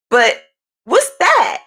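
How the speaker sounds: imitated grandma, pitch jumping up on the question; grandma's way of talking. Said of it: "That's how she was talking".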